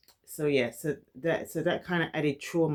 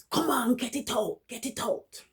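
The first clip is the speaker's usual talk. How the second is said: higher, harsh father's demand; strict father; 8 semitones higher than usual. Retold or imitated. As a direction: push the child to spit the word out. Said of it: "my dad would literally hit me".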